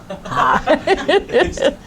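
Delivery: high burst of laughter; amused laugh. Real, not acted. Teacher hearing a misread word, amused high laugh.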